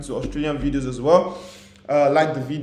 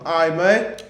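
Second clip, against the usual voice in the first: Aussie impression; 5 semitones higher, 5 dB louder than usual. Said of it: "a character in Apex Legends that talks like this".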